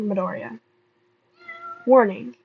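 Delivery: ordinary talk, nothing marked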